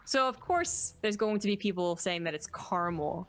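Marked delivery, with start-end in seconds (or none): 2.5-3.3 caramel said as CAR-mul; two syllables in caramel, middle A dropped; caramel without second A. Shown as a demonstration. Citing what some people say, caramel squeezed to CAR-mul. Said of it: "despite there being a second A".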